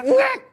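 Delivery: sharp, very high loud "ngek" jolt; startled yelp. Pretend. Tired mother jolted from sleep: sharp yelp. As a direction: yelp like a sudden shock. Imitating being jolted awake.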